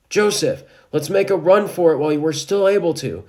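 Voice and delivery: loud, high urgent call; urgent father. A narrator's reading. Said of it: "Joseph's father takes initiative".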